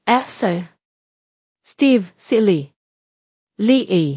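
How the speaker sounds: slow, broken-up tearful phrases; halting through tears. A narrator's reading. Mother-in-law phoning Steve with terrible news, halting through tears. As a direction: break the words apart, mildly tearful. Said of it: "Putol-putol ang pagsasalita nito dahil sa pag-iyak".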